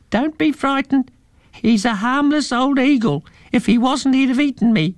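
very high, squeaky little mouse; a high-pitched friendly mouse. Acted. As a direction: soothe the children, tiny and high. Talking pink mouse speaks.

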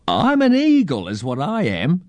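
high-pitched opening, easing down; eccentric old bird-man. A narrator's reading. Old man tells children what he is, high bird-man voice.

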